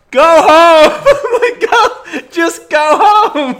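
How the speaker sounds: high, loud, laughing go home; laughing plea. Retold or imitated. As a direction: beg them to leave while cracking up. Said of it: "I'm like, I'm just like".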